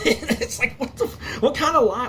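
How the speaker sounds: high, disbelieving squeak; what kind of lie, in disbelief; incredulous. Real, not acted. Incredulous outburst.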